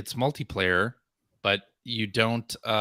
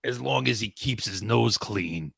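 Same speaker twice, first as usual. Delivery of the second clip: lightly voiced low mobster; a hint of old-time gangster, pitched down; 4 semitones lower than usual. Co-host slipping into character over a legal story, mobster. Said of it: "he sounds like a, like a mobster".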